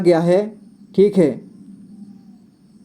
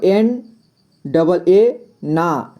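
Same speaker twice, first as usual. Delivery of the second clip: slow spelling; much slower than usual. Shown as a demonstration. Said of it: "spelling out slowly".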